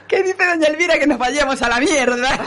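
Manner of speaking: high, giggling daughter's question; laughing girl voice. Retold or imitated. Daughter Matilde asking her mother, high laughing voice. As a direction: ask it high while laughing.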